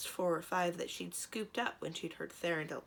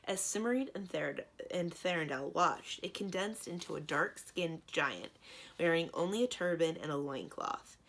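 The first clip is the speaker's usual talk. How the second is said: usual speaking voice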